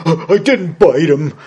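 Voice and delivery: loud, high, drawn-out protest; whining cowardly lion. Acted. Slapped lion defends himself to Dorothy, loud whining protest. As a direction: protest like a wounded big coward.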